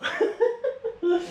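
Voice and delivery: soft high-pitched laughter. Real, not acted. Reactor laughing after greeting Abra, amused. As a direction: let out a high, light laugh.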